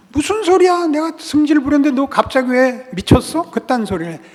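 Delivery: high, loud mock indignation; irritated retort. Angry friend answering an unexpected compliment, irritated retort. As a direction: fire back as a baffled, annoyed friend. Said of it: "짜증내고 원망하고 화를 내면".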